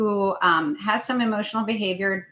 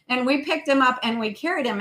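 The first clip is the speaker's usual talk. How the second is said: usual speaking voice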